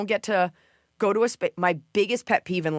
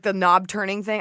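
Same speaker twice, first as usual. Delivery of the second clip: usual speaking voice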